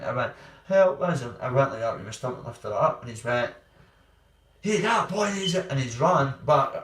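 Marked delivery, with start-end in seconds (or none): 0.6-1.2 high, loud pleading yell; a burned boy crying out at a door; desperate cry for help. Retold or imitated. Burned boy begging at stranger's door. 4.6-5.7 higher, louder urgent call; alarmed shout. Retold or imitated. Bodybuilder reacts to the burns.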